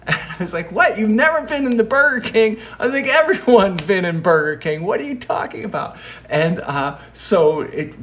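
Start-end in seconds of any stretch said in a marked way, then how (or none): 0.7-6.0 high, loud, amused disbelief; quick, high-pitched incredulous challenge; mock incredulity. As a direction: retell it as flabbergasted, pitch way up. Disbelief at the waiter's claim.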